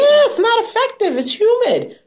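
sudden high excited outburst; too loud. Real, not acted. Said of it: "You so loud".

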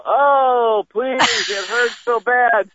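high, slow, hammed-up wail; badly faked suffering, drawn out; faked agony. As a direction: plead in exaggerated fake pain. Child fakes pain under padded pants.

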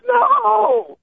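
high, strained cry of no; high-pitched imitated scream of no; imitating a scream. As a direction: mimic a friend screaming in panic. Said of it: "I can hear him, like, screaming".

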